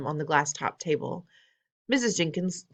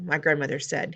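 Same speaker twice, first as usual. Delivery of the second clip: ordinary delivery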